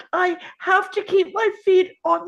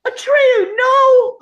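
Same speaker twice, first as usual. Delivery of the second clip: desperate shout, higher and louder; anguished cry; 6 semitones higher, 8 dB louder, slower than usual. Acted. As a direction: cry out in horror for a fallen friend. Friend thrown to the ground.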